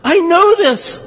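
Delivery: high, loud, eager know-it-all whine; Horshack. Retold or imitated. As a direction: beg the teacher to call on you, voice up high. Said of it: "You're like Horshack on Welcome Back, Kotter".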